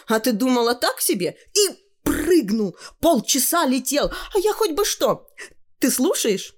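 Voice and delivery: put-on high, dramatic girlish tale; high-pitched, carried away by her own story; boasting girl. Tanya boasts of her admirer.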